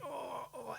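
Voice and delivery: soft, high mock groan of pain; hushed, high-pitched re-enacted moans; imitated pained groan. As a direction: groan "oh, oh" quietly, as if jolted. Acts out his back hurting in car.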